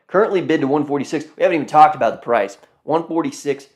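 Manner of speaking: fast excited rush. Real, not acted. Excited rush about price.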